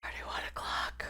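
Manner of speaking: whispered under the breath, barely voiced; whispered aside. Real, not acted. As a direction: breathe it out to yourself, late at night. Muttering the time to himself.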